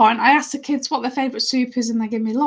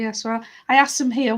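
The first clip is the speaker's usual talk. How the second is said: ordinary delivery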